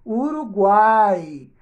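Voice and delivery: Uruguai drawn out slowly and loudly; slow word demo. Shown as a demonstration. Teacher giving pupils a word to split, slow and loud. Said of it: "Separa Uruguai em sílabas".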